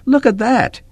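excited high call. A narrator's reading. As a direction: shout it across the field. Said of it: "Kip called out".

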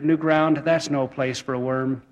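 ordinary delivery

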